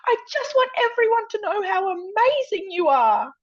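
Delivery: high, loud, overwrought outburst; retold yell. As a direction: yell it out like an emotional audience member. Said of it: "she just yells".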